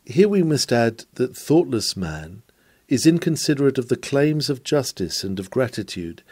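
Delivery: ordinary talk, nothing marked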